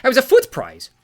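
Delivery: protest, startled not angry; startled sea god. Acted. Sea god answers the dwarf's insult, startled. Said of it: "more startled than angry".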